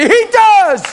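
loud, high, emphatic reply; preacher answering a doubter, loud and sure. Real, not acted. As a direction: declare it back at the doubter.

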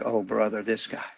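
soft, muttered mock disdain; a hint of bored teens; mock eye-rolling kids. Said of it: "walked away like".

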